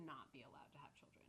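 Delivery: whispered verdict; whispered aside. Real, not acted. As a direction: whisper it like a secret judgment.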